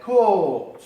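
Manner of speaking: long English O in coach; 'coach' with a long drawn O sound. Shown as a demonstration. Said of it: "Coach es el pasado de catch".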